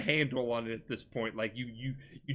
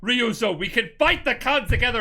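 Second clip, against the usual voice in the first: acted impassioned plea; 7 semitones higher, 6 dB louder than usual. Hero urging his betraying friend to fight together, impassioned plea. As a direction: plead loudly as a retold game hero.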